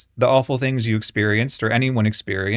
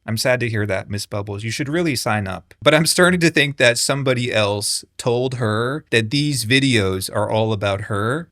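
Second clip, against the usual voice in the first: ordinary talk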